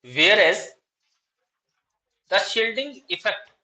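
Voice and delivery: slow, deliberate dictation, each phrase spaced out. Shown as a demonstration. Teacher dictating exam answer to students, slow dictation. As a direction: read out notes word by word for students to copy. Redictates after student missed part.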